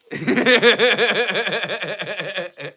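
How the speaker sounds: long staccato cackle; chuckling laugh. Real, not acted. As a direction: let out a long run of rattling laughter. Laughs after teasing about dirty mind.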